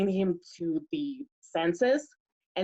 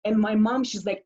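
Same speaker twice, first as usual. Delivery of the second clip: normal talking voice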